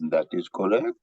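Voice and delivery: put-on deep, measured German-accented psychiatrist; a stiff German doctor's accent. Psychiatrist calmly confirming, German accent.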